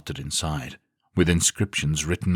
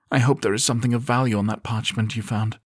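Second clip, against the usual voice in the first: higher woman's voice, tired; 7 semitones higher than usual. A narrator's reading. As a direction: voice the weary companion. Female character voiced.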